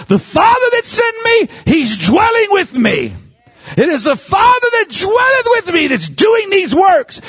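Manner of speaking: high, shouted sermon delivery; full-force preaching. Real, not acted. Preacher insisting the Father dwelt in Jesus, shouted high. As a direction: preach it hard, at the top of your range.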